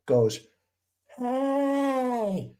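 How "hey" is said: high, calling out across the water; high-pitched mock hail; retold yell. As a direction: hail the other boat like Dad did. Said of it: "starts yelling at this guy".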